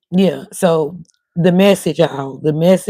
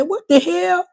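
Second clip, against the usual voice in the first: much higher startled exclaim; retold shock; 13 semitones higher, slower than usual. Telling viewers her reaction, mock shocked. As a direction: pop up high in mock disbelief. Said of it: "I said".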